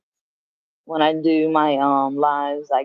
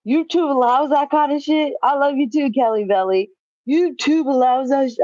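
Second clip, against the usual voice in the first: mock outrage; 8 semitones higher than usual. Mimicking his shocked reaction in retelling.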